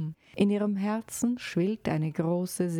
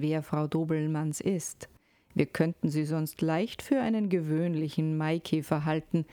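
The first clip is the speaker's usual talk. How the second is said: plain reading voice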